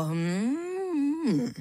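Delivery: long drawn-out "hmm" that swells up in pitch and falls; distracted, stretched hum; absent-minded murmur. A narrator's reading. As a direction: hum vaguely, only half listening. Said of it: "zamručel cosi jako".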